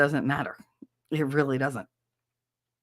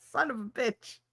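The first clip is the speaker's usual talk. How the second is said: ordinary talk, nothing marked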